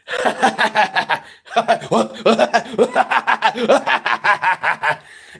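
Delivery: high, loud, long imitated maniac cackle; crazy cackle. Grandson demonstrates how grandpa laughs, crazy Green Goblin cackle. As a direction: cackle like a cartoon supervillain, in bursts. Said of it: "doing this crazy laugh he always does, which sounds like the Green Goblin".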